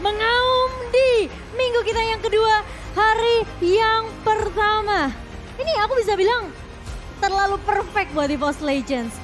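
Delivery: loud, excited celebration; hyped praise. Acted. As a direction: gush over the win. Post-game hype praise. (pujian penuh semangat dan keras)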